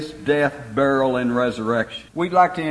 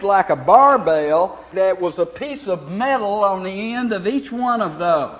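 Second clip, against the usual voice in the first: emphatic sermon voice, swelling on key words; preaching; 5 semitones higher, 3 dB louder than usual. Real, not acted. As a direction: drive each point home to the congregation. Preaches on scourging and sin.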